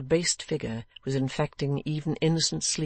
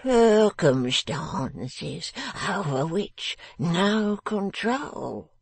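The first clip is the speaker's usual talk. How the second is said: slow, wavering, drawn-out plea; slurred pleading; 5 semitones higher, much slower than usual. A narrator's reading. Drunken father makes excuses to Miss Wren, pleading. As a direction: plead like a pitiful drunkard, pitch sliding about. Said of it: "he again put out both his hands and pleaded".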